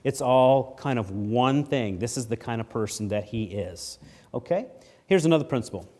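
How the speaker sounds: normal talking voice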